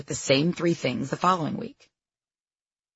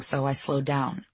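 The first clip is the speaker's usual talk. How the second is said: usual speaking voice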